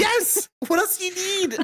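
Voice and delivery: excited. Pretend. Mock-defending the family feast.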